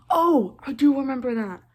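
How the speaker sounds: loud, high burst of realisation; excited jolt of memory; sudden recollection. Real, not acted. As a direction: blurt it out as it comes back. Suddenly recalls the scene.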